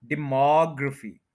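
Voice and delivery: Demography with the second syllable MOG stressed. Shown as a demonstration. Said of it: "इसका MOG पे stress है".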